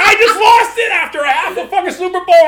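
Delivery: high, loud mock outrage; indignant protest. Husband scolded for one swear, shouted retold protest.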